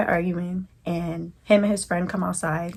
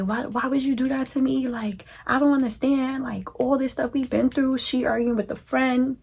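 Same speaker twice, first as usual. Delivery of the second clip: crying; 6 semitones higher than usual. Retold or imitated. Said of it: "I'm crying".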